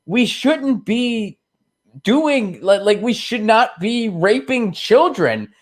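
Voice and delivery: high, slow, pleading priest voice; a hint of a timid, earnest cleric. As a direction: play the lone good priest begging his superiors. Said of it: "and he goes".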